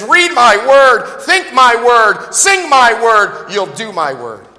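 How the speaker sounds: each phrase drawn out like a decree; voice of God commanding. Acted. God telling his people to take in his word, slow and loud. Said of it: "And so he says".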